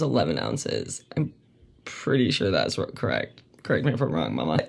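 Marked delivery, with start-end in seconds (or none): none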